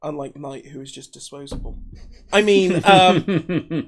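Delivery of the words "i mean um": slow words through a laugh; cracking up mid-sentence. Real, not acted. Laughing at joke about Knight.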